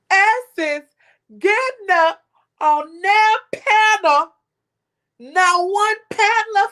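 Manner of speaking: pushing the point hard, voice climbing; heated, loud call-out to the chat; fired up. Real, not acted. Heated rant about Essence not defending.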